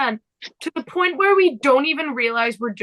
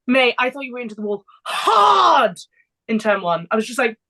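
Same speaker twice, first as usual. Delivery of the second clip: ordinary talk, nothing marked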